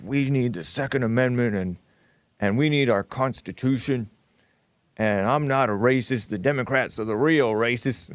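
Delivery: a lightly voiced parody of a boomer Trump supporter; mocking boomer voice. Host mocking boomer Trump supporters, mild parody voice. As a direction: mildly mimic a earnest conservative boomer. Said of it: "making fun of the dumb, what they, in their mind, Trump supporters who are like".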